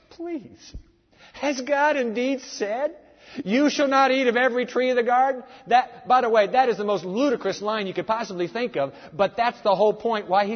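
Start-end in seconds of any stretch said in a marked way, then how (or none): 0.1-5.4 mocking serpent voice. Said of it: "And he said to the woman".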